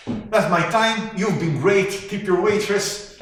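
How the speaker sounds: loud, high club-comedian goodbye; mock comic sign-off. Eulogist pretending to end a stand-up set, loud showbiz sign-off. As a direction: belt it out like closing a comedy set.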